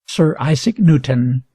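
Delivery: ordinary talk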